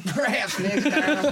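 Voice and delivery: laughing through an Australian magistrate line; giggling, slow mock Australian judge; mock Australian accent. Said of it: "it'd be like that, but Australia".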